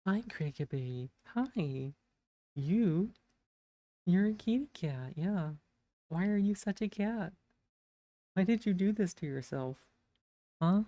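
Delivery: high cooing pet voice. Real, not acted. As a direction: coo at the kitty. Baby talk to cat.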